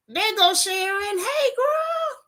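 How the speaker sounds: high-pitched, drawn-out squeal of hello; excited high call-out; hollered greeting. Real, not acted. Hollering greeting to her friend Sharon.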